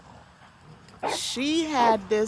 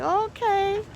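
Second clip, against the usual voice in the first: high, louder skeptical okay; a raised-eyebrow okay, pitched up; surprised okay; 10 semitones higher, 4 dB louder than usual. Retold or imitated. Telling her neighbor's story, a high doubtful okay. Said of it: "I was like".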